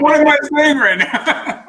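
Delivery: high, slow words through real laughter; laughing while talking. Real, not acted. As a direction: squeal it out while cracking up. Cracking up at the anecdote.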